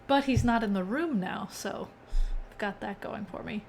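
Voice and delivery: ordinary talk, nothing marked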